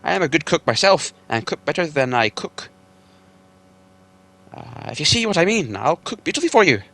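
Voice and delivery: gasping plea. Acted. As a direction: beg the trolls while gasping for air.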